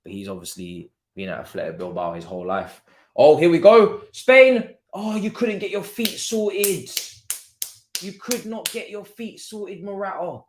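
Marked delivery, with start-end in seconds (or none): 3.1-4.8 loud, high burst of anticipation; excited shout. Real, not acted. Fan as Spain break forward, excited shout. Sudden Spain attack in watchalong. 4.9-10.4 high, drawn-out fan's lament; slow, high-pitched complaint at a wasted chance; exasperated disbelief. Real, not acted. Fan to Morata after a missed chance, exasperated.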